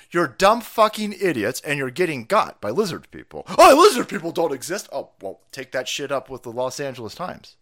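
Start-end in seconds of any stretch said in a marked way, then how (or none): 3.6-4.9 loud, mocking quote; mocking doubter. Mocking people who deny lizard people, loud derision. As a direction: throw it back loudly in mockery.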